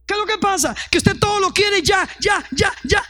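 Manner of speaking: preaching shout. Real, not acted. Pastor scolding the congregation's impatience, shouted high. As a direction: hammer the repeated ya at full voice.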